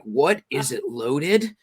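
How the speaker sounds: slow, drawn-out villain impression; diabolical, seductive. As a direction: stretch every word like a sinister seducer. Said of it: "sound diabolical yet somewhat seductive".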